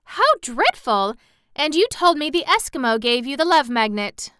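high, loud reproach; shocked cry. A narrator's reading. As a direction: scold a friend in dismay. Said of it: "cried Dorothy".

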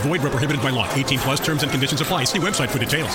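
quick low fine-print read; fast disclaimer. Acted. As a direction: rattle off the legal disclaimer.

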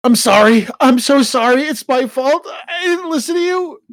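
high, loud, breaking apology; tearful apology. Acted. Son sobbing apology to mother.

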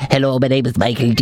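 low-voiced put-on statesman; Michael D. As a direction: drop the pitch into a public figure's voice. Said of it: "we'd be like".

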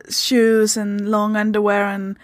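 ordinary delivery

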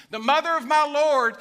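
very loud, high-pitched exclamation; Elizabeth shouting. Acted. Elizabeth hailing Mary as she arrives, exuberant shouting. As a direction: cry it out in exuberant awe. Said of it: "She starts shouting".

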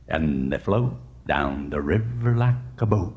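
hushed, low storybook menace with long vowels; ominous crocodile warning. Acted. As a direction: draw it out to spook little kids. Animal warns monkey about crocodiles.

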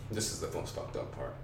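ominous whispered aside; hushed. Real, not acted. Hushed build-up before scary part.

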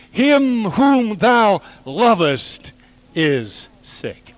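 high, slow, drawn out; lifted, slowed prayer line; slow emphatic plea. Shown as a demonstration. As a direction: stretch each word like a pleading prayer. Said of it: "Come to him the next time with your prayer request".

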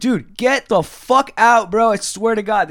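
high, loud, drawn-out order; retold fed-up shouting; exasperated yell. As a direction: throw him out at the end of your patience. Retelling his screaming match.

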